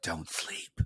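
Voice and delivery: hushed, breathy tagline whispered to camera; dramatic whisper. Shown as a demonstration. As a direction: whisper it straight into the lens like a movie trailer. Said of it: "look into the camera and say".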